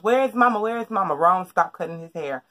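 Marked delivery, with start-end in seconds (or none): 0.0-0.6 higher, louder panicked call for mama; retold panicked call; frantic search. Running around looking for mom. 0.6-1.1 retold anxious second call; worried repeat. Running around looking for mom.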